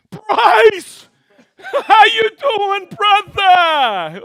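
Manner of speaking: high, very loud, drawn-out holler with laughter; excited shouted greeting. Real, not acted. Streamer greeting a friend in the chat, excited shouting.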